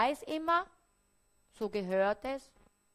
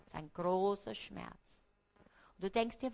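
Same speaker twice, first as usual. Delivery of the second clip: normal talking voice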